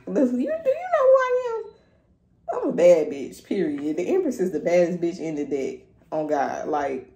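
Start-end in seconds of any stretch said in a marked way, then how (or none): 0.1-4.0 high, slow, sassy diva voice; mock-haughty queen, drawn out. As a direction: strut it like the baddest bitch in the room. Voices a sassy, confident persona.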